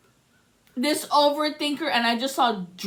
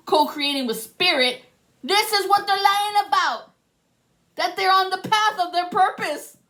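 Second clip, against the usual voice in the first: raised and higher, pressing every point; heated impassioned outburst; passionate rant; 6 semitones higher than usual. Real, not acted. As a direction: preach it like you're fed up. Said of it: "I'm sorry to get so loudly and passionately".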